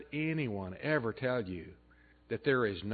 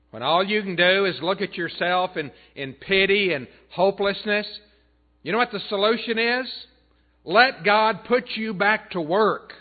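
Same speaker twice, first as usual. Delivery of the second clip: preaching climax; 7 semitones higher, 10 dB louder than usual. Real, not acted.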